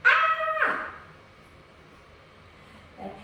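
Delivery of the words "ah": high open-throated scream, much higher; gentle scream. Shown as a demonstration. Prenatal yoga teacher demonstrating a gentle scream. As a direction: let out a demo scream for the class. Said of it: "I want you to scream".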